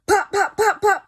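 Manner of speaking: high, punchy pop sounds; imitated punches. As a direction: pop each hit out sharply. Retelling punches thrown.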